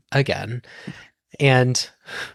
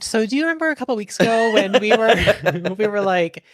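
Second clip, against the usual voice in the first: laughing; 9 semitones higher than usual. Real, not acted. Laughs recalling recording mishap.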